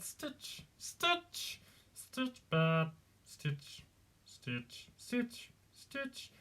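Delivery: trying out a little alien voice, pitch hopping about; short creature words, mostly high and soft; Stitch. Shown as a demonstration. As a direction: test a small alien critter voice. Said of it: "finding the right terms with Stitch".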